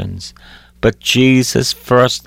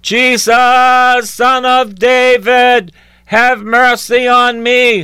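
a high, loud, slow cry for mercy; 12 semitones higher, 4 dB louder, slower than usual. A narrator's reading. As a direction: call out desperately, high and long, to someone you cannot see. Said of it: "he began to cry out and say".

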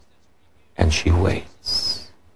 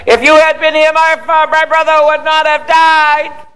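loud, very high wail of reproach; hammed-up upset Martha, pitched way up; upset outburst; 6 dB louder than usual. Preacher acting Martha confronting Jesus over her brother, upset outburst. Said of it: "She was upset".

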